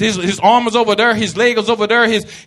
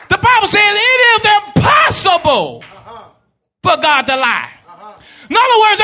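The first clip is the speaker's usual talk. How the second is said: raised, pressing preacher cadence with shouted peaks; full-force preaching; 8 semitones higher than usual. Acted. Preacher exhorting the church, full-force preaching. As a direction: preach it hard to the congregation.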